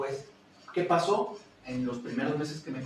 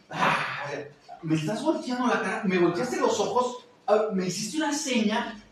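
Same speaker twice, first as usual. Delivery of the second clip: offended teacher; 6 semitones higher, 4 dB louder than usual. Retold or imitated. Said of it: "qué hacen otros profesores".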